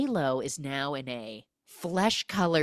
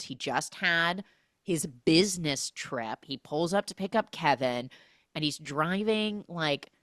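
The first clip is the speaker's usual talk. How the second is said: ordinary talk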